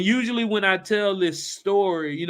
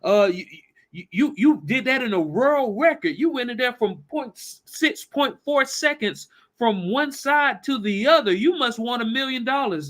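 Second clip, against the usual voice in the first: high, bursting with amazement; a father thrilled by the young swimmer's speed; excited; 8 semitones higher than usual. Acted. Jed exclaims to John, high and excited.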